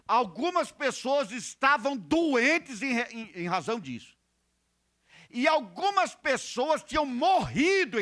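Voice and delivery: high, loud pulpit delivery; forceful preaching. Real, not acted. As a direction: hammer the warning into the congregation.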